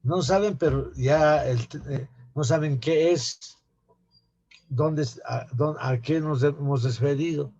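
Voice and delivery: ordinary talk, nothing marked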